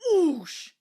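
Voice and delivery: loud, high angry steam hiss; cross hiss. A narrator's reading. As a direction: blow off steam at them crossly. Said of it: "hissed Toby crossly".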